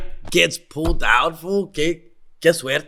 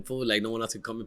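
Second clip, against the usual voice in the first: ordinary talk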